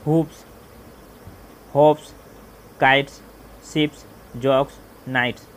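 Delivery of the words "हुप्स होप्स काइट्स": Hoops said loud and separate; loud example words. Shown as a demonstration. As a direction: call out each word to the class. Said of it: "जैसे roofs". (ज़ोर से उदाहरण)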